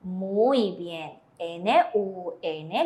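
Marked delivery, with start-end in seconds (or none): 1.4-2.8 "N U N" letter by letter, slow; teacher spelling the syllable slowly; spelled out slowly. Shown as a demonstration. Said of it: "leemos nun".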